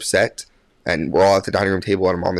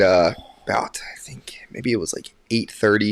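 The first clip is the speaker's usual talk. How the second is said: ordinary delivery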